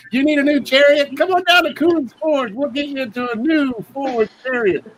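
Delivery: high, hammed-up car commercial pitch; car dealer ad. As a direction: sell the chariot like a used car salesman.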